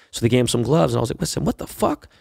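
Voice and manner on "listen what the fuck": incredulous. Retold or imitated. Retold alarm at the news.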